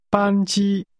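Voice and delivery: 班机 with both syllables held high and level; two high-level tones. Shown as a demonstration. Teacher models flight for learners, two high-level tones on 班机. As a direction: say 班机 on a steady, high pitch, no rise or fall. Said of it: "That's two high-level tones".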